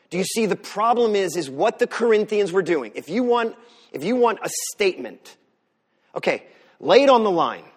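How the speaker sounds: loud, rising sermon delivery; preaching. Acted. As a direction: preach it out with full force. Preaching at full force.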